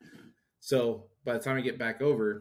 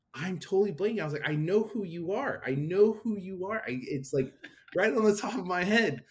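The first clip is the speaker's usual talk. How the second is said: flustered; 9 semitones higher, 5 dB louder than usual. Retold or imitated. Flustered retelling of blanking on name.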